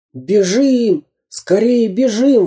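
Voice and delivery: high, drawn-out alarm; urgent exclamation. A narrator's reading. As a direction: urge everyone to flee. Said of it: "воскликнул Самоделкин".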